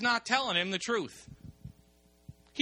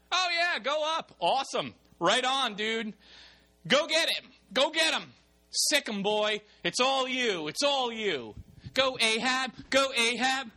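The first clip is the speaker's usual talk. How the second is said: higher, louder mock cheerleading; sarcastic hype; 6 semitones higher, 3 dB louder than usual. Micaiah mocking the king's war plan, sarcastic cheer. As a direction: pump him up while plainly not meaning it. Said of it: "Maybe there's something in his tone".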